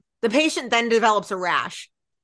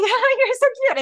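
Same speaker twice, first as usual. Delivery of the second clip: much higher, gushing coo; mock fawning; 12 semitones higher, 4 dB louder than usual. Fan in front of Will, playfully fawning. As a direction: play at being smitten, pitched way up.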